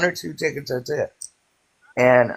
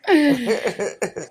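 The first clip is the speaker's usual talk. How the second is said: laughing; 7 semitones higher than usual. Real, not acted. Laughs after lottery story.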